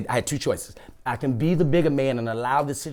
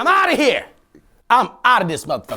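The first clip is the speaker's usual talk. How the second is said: mock hasty exit; 6 semitones higher than usual. Acted storming out of the bank.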